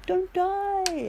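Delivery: high pleading plea; begging. Retold or imitated. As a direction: beg it not to die. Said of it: "and I begged it".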